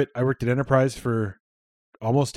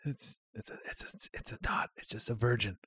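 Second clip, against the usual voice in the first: sneaky, very soft whisper; confidential, voiceless murmur; hushed whisper; 3 semitones lower, 14 dB quieter than usual. Retold or imitated. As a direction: whisper it haltingly like a secret order. Quietly tipping off the bartender.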